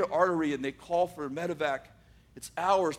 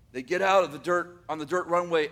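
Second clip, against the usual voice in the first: ordinary delivery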